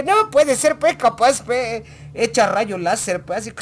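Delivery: high, loud mock outburst; mock exclamation. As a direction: blurt it out at high pitch, exaggerated. Acted exclamation in a joking retelling.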